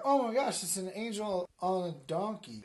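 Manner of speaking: high, loud mock amazement; astonished. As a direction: gasp out the news in surprise. Acted Mary's surprise in story.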